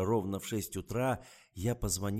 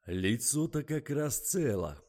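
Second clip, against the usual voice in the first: curt, slow interruption; brusque general cutting in; 7 semitones higher, 6 dB louder, slower than usual. A narrator's reading. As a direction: cut him off firmly. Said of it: "оборвал издателя генерал".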